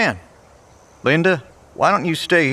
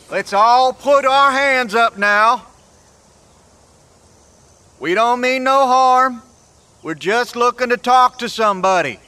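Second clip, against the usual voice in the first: calling out; 6 semitones higher than usual. Acted. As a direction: call out so hidden strangers know you mean no harm. Calling out to unseen strangers.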